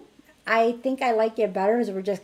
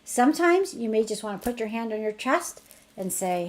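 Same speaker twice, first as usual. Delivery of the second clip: ordinary delivery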